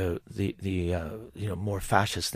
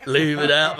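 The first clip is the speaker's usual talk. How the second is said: put-on Cockney accent; 4 semitones higher, 7 dB louder, slower than usual. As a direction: leave it out, laughing, stronger Cockney. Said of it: "Do your Cockney accent again".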